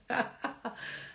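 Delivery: high, soft laugh; real amused little laugh. Real, not acted. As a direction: let out a light, high chuckle. Amused at her pointless grudge.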